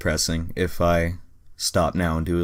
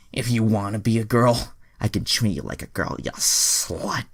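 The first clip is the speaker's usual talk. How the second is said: menacing line; 5 semitones higher, 4 dB louder than usual. Acted. Kieran threatens Troy.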